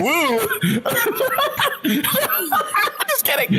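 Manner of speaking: high squealing laugh. Real, not acted. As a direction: laugh through the words. Laughing fit.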